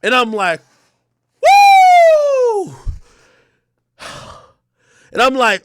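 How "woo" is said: very high, loud woo of relief; relieved whoop. Retold or imitated. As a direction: let out a victory whoop. Relief when she bails him out.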